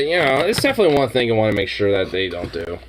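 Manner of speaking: ordinary talk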